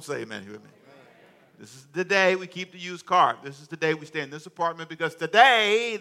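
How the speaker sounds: loud, rousing preacher cadence; preaching at full force. Acted. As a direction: preach it out to the congregation.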